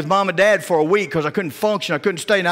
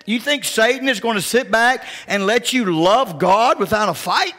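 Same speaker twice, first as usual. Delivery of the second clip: normal talking voice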